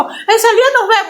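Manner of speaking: high-pitched imitation of a beaten bully; mock threat.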